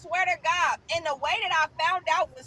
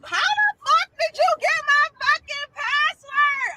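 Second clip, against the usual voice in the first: high, slow, loud accusation; furious demand; 10 semitones higher, 3 dB louder than usual. Retold or imitated. Confronting the boyfriend about her password, high slow fury. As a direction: spit each word out at him, high and furious.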